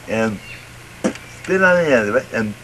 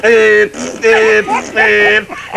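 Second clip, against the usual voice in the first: loud beeping horn sounds; horn beeps; 5 semitones higher, 4 dB louder, much slower than usual. Retold or imitated. Storyteller honks as the bull farts, loud beeps. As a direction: honk each beep like a car horn.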